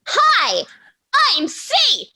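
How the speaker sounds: high-pitched shrieky character voice, stretched-out words; chirpy high threat, as if constantly surprised; Saith, surprised-sounding. Acted. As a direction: squeal a cheerful death threat. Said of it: "just screaming, basically".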